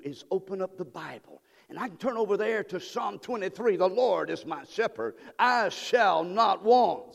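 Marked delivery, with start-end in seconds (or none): 1.7-7.1 slow, drawn-out psalm recitation; preaching scripture. Real, not acted. Pastor reciting Psalm twenty-three, slow and fervent. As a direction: savor each word of the psalm.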